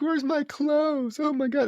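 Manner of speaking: slow, high mock-desperate wail. Woman searching for her stolen clothes, mock desperate. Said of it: "like, desperate".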